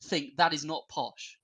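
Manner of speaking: normal talking voice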